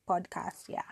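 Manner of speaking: a soft unvoiced "podcast" to herself; whispered correction. Real, not acted. Correcting herself in a hushed whisper. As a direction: whisper the word podcast under your breath.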